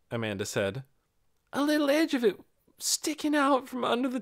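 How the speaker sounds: lightly voiced young girl, confiding; a touch of a guilty girl. Girl character voiced throughout.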